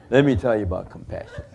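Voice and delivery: mock preachy teacher voice; pompous teacher. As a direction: play the hypocrite sensei grandly. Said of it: "he goes".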